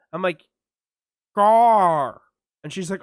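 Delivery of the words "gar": louder, mushy grunt through gauze; mock mumble through a numbed mouth. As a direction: try to answer with a mouthful of gauze. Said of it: "this voice you're doing for you with this numbed up, gauzed up mouth".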